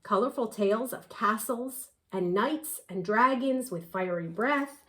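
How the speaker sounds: plain reading voice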